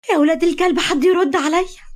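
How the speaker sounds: high, loud desperate cry; frantic yell. Acted. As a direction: scream at the unanswered phones. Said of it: "شدت شعرها بجنون وهي بتقول". (صراخ هستيري عالي)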